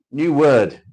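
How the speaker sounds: high, loud game show call; mock announcement. Reading the next word card to viewers, loud mock announcement. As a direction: boom it out like a quiz host.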